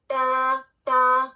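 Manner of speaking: تا said slowly and clearly, tongue tip on the gum ridge; letter ta demo. Shown as a demonstration. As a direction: draw out تا slowly, a light thin t. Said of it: "تو آپ کا تا ادا ہوگا".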